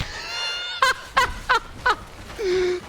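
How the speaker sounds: long, high-pitched laughing fit; delighted laugh. Real, not acted. Commentator watches the dwarf crushing everyone, high delighted laugh.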